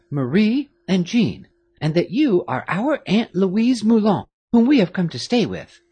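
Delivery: put-on a touch of a careful young lady; girl explaining the disguise; lightly voiced young girl. A narrator's reading. Marie voiced in dialogue.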